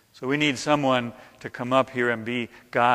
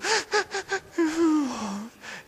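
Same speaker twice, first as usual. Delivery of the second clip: high-pitched fake sobbing; mock boo-hoo crying; 13 semitones higher, 4 dB quieter than usual. Leading the congregation to cry for a bit, mock boo-hooing. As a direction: pretend wailing in short hoots. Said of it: "just kind of boo-hoo".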